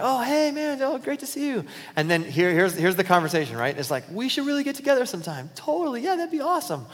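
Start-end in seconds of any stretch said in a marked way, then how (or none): 0.0-1.6 cheery fake greeting. Acted casual hallway greeting. 4.1-6.8 breezy small talk. Retold or imitated. Acted empty small-talk exchange.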